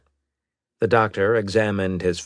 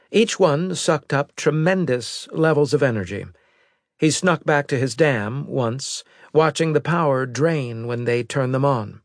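higher, crisp briefing voice; brisk military woman reporting; female officer voice; 8 semitones higher, 4 dB louder than usual. A narrator's reading.

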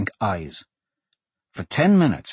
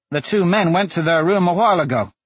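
put-on high-pitched little boy reporting; small boy; 7 semitones higher, 3 dB louder than usual. A narrator's reading. Small boy reports to the two swordsmen, high boyish voice. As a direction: pipe it up like a young lad.

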